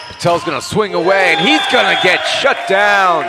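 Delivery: high, loud call of the stuff block; excited shout. Real, not acted. Announcer shouting as Patel gets blocked. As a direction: yell the shutdown to the fans. Calling an attack being blocked.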